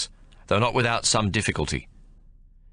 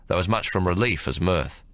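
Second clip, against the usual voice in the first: ordinary delivery, nothing marked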